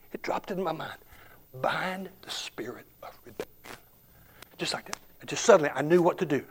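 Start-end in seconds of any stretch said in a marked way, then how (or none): 1.6-3.5 breathy unvoiced whisper. Preacher recalls God's prompt to the congregation, whispered. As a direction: hush it like a secret voice in the mind.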